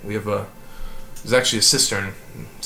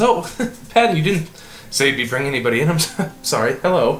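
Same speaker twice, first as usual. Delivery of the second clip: higher, fast, laughing farmwife; flustered wife; 7 semitones higher, 6 dB louder, faster than usual. Acted. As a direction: caught off guard by guests, apologising. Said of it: "She says".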